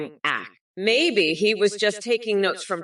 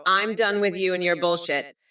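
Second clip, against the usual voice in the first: usual speaking voice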